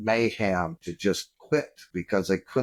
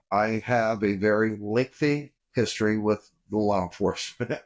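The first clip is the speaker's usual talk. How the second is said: usual speaking voice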